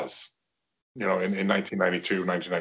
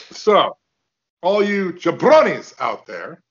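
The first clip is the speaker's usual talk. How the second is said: higher, louder, slow wrestler bark; a light take on the Sheik, calling out the jabronis; Iron Sheik impression; 7 semitones higher, 5 dB louder, slower than usual. Shown as a demonstration. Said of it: "then do your Iron Sheik".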